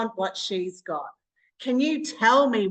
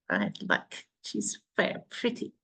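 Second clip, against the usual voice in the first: whispered, softer, just to herself; whispered aside; 13 semitones lower, 6 dB quieter than usual. Real, not acted. Host whispering a compliment about Emily on screen. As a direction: let the compliment slip out under your breath.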